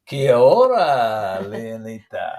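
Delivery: put-on slow drawn-out Spanish accent greeting. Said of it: "You're saying that in a, um, uh, Spanish accent".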